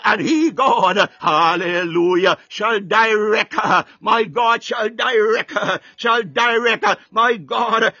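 ordinary delivery

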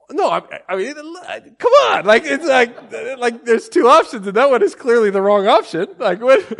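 loud, high-pitched flustered protest; exasperated outburst. Retold or imitated. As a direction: argue back in hammed-up disbelief. Retold exasperated argument with wife.

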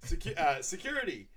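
high, loud mock announcement; put-on presentation voice; imitated presenter. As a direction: announce it like a slide heading. Acting out a business presentation.

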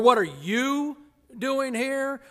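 you stressed and stretched, with a pause after. Shown as a demonstration. Preacher testing where the emphasis fell, stressing you. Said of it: "where was the emphasis?".

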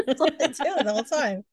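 quick line through real laughter; laughing. Real, not acted. Co-host repeating the joke, laughing. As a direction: laugh while you agree.

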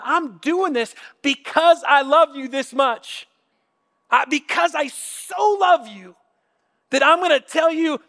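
high, earnest declaration of love; impassioned love. Acted. God voiced declaring love behind command.

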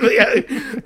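high, loud laugh bursting through the words. Real, not acted. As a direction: agree while cracking up. Friend teases him, he cracks up.

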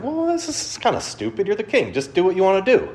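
mock kid voice, exasperated; preacher recalling a childhood thought, high kid voice; kid's exasperated thought. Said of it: "And, and as a kid".

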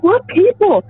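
high, mock-yelled question; high-pitched exasperation; retold screaming. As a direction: act out freaked-out disbelief. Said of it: "Like, I'm screaming".

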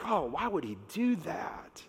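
whispered, slow complaining; grumbling whisper. Retold or imitated. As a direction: mutter it like a resentful scribe. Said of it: "they're grumbling and they're complaining".

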